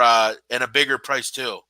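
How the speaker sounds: ordinary talk, nothing marked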